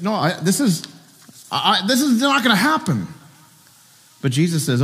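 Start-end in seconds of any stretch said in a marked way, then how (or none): 0.0-3.1 higher, stammering disbelief; incredulous. Retold or imitated. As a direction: refuse to believe it could happen. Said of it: "He's incredulous".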